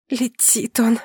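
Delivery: whispery rasped sneer; hoarse croak. A narrator's reading. Wife sneers after her departing husband, hoarse croak. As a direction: rasp it out with little voice left. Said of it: "прохрипела я".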